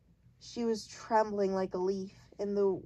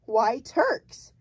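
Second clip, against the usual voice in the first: loud, higher indignant outburst; angry blurt; 3 semitones higher, 7 dB louder than usual. A narrator's reading. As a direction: blurt it out offended. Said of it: "she blurted out".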